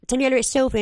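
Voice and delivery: high, loud, bright call of her name; head teacher on the phone. Retold or imitated. Retelling head of school phoning her at home, high and loud. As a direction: announce yourself like the school head ringing. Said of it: "her saying".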